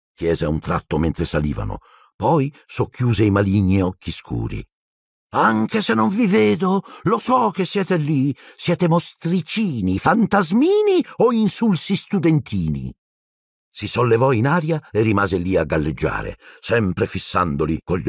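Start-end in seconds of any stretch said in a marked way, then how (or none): 5.3-12.9 high, slow, drawn-out sprite voice; taunting poltergeist. A narrator's reading. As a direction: tease them slowly in a high voice. Peeves taunts the unseen students. (spiritello beffardo, voce acuta strascicata)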